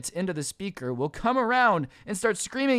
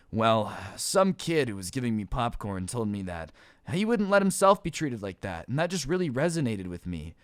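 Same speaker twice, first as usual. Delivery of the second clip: mock-solemn explanation; 9 semitones lower, 3 dB quieter than usual. Acted boyfriend explains the breakup solemnly.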